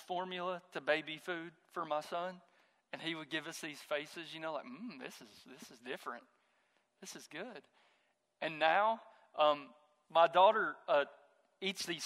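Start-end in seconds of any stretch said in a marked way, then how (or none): 4.7-7.6 soft, pondering first-taste murmur; quiet mock tasting. Preacher voices his baby son trying new food, quiet pondering. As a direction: murmur it like sampling something new.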